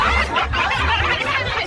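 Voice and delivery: helpless high burst of laughter; high-pitched laughing fit. Real, not acted. Breaking up during the diagnosis.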